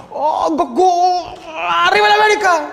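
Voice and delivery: long drawn-out high scream turning into a cheer; hammed-up high, loud yell; mock scream. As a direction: stretch the hero's cry, then belt a silly slogan. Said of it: "le gritó".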